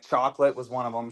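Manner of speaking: normal talking voice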